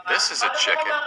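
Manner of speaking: very high, put-on clucky voice; playful high chicken impression; squeaky chicken voice. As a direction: chirp it out like a little hen. Introducing the chicken picture.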